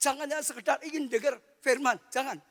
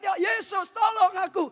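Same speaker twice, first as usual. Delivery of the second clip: crying out; 10 semitones higher, 3 dB louder than usual. Retold or imitated. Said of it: "Dia teriak".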